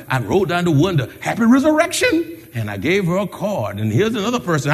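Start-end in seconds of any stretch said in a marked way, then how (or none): 1.2-2.3 high-pitched cheerful call; sing-out greeting, higher voice. Retold or imitated. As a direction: greet a passer-by through the car window, pitched up. Greeting passer-by from car window.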